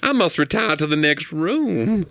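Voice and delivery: slow, genteel belle voice; Southern belle. Retold or imitated. Comic keeps the Southern belle bit going, slow and genteel. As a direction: swoon like a fainting belle.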